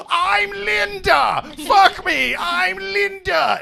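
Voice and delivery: high, slow shouted woman voice; female voice. Acted. Waking him as the ex.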